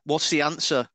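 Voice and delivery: ordinary delivery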